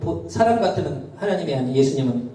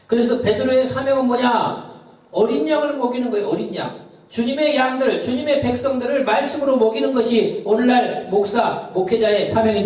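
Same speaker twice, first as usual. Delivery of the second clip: fervent preaching; 9 semitones higher than usual. Acted. Preaching climax.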